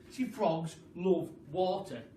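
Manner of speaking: ordinary delivery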